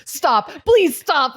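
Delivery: high, drawn-out begging; mock desperate pleading. Host acting out tickling victim, mock desperate plea. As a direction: beg the tickler to stop, slow and high. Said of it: "by the end you're like".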